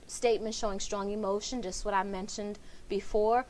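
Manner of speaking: normal talking voice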